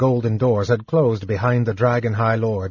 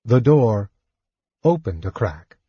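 plain reading voice

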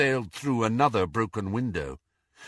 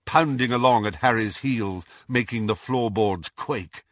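ordinary delivery, nothing marked

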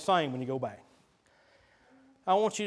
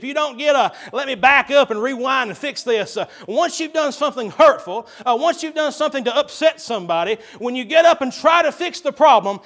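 loud, higher fervent sermon; preaching at full force; 6 semitones higher, 9 dB louder than usual. Real, not acted. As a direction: hammer it home to the congregation.

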